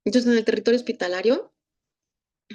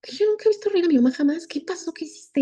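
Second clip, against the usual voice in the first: daughter's amazed voice; 7 semitones higher than usual. Retold or imitated. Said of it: "decía".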